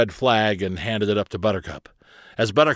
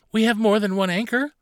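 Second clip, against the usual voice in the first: put-on high, puzzled child's question; confused; 11 semitones higher than usual. A narrator's reading. Carly asks Barnabas about anchors, high and confused. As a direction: ask it high, baffled by the news.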